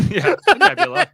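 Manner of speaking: squeaky laughing echo of the nebula. Retold or imitated. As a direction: laugh out the nebula, high and loud.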